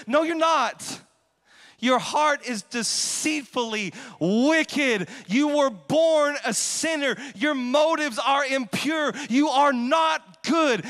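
loud, high, slow hammering sermon; preaching at full force. Real, not acted. Pastor confronting the church about sin, shouting. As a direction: pound each line home. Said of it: "preaching at full force".

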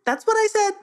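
high, cheeky squawking parrot; high saucy birdlike voice. A narrator's reading. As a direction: sass the words back like a bird. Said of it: "in a very saucy voice".

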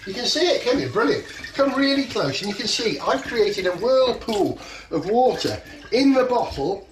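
projecting loudly to the whole room; big excited show-and-tell voice; loud presenter voice. Real, not acted. Showing the bottle trick to kids.

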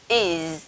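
"is" soft and drawn out with a buzzing z; IS ending sound. Shown as a demonstration. Teacher summing up for students, "is" drawn out softly. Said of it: "cái cách phát âm".

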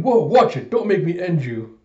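mock-alarmed warning; imitated threat. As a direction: jump loud on "whoa", then threaten. Threat after near miss, acted.

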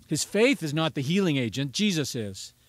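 ordinary delivery

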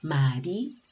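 "Marino" as mari, pause, long nooo; sounded out syllables. Shown as a demonstration. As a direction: linger on the last O of "Marino". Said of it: "o terminó con O".

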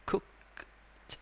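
cooked with the final t as a puff of air; aspirated final t. Shown as a demonstration. Teacher demonstrating the -ed ending: aspirated final t. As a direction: let the air out on cooked, kukt. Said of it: "Rồi, tiếp tục".

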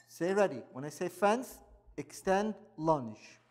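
crisp fencing commands. Real, not acted. Instructor calling fencing commands.

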